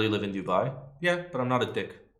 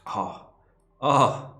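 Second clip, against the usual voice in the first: oh rising louder each time; building excitement; 4 semitones higher, 6 dB louder, much slower than usual. Real, not acted. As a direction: build from mild to thrilled.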